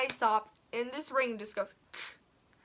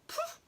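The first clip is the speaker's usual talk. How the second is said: 1 sudden poof; 7 dB quieter than usual. Retold or imitated. Imitating the piercer's quick jab.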